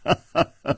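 short mocking cackle; scornful laugh. A narrator's reading. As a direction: burst into a brief staged laugh. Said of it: "大笑". (得意地短笑)

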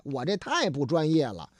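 ordinary delivery

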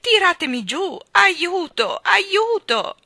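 high, loud calls for help, building; panicked cries. A narrator's reading. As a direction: shout desperately to be pulled down. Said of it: "gridò la signorina Tartina". (grida acute e disperate d'aiuto)

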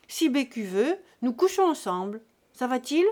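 put-on loud, jovial drunken host; boisterous drunk. A narrator's reading. Drunk Jésus-Christ ordering a feast from the landlady, booming. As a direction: play the big spender, loud.

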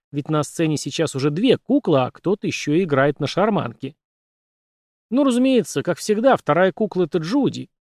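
ordinary delivery, nothing marked

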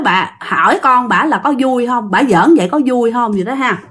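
normal talking voice

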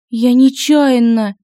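high, slow, tearful; almost crying, apologetic; near tears. A narrator's reading. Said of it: "чуть не плача сказала девушка".

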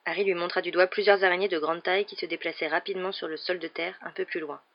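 ordinary delivery, nothing marked

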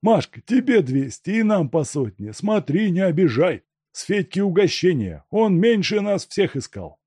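high, eager voice of a thief sharing loot; greedy thief Vaska. A narrator's reading. Vaska divides the reward among the gang, eager. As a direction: count the money out with relish.

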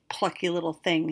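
ordinary delivery

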